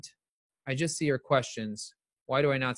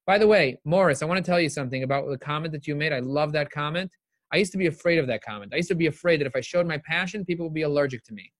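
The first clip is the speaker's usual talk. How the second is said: normal talking voice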